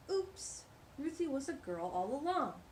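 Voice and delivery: ordinary delivery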